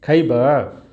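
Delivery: "खैबा" in a Bhojpuri lilt. Shown as a demonstration. Teacher showing intonation to students with a Bhojpuri lilt. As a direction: give it the wave of Bhojpuri speech. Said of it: "उसमें कितनी बढ़िया लहर है".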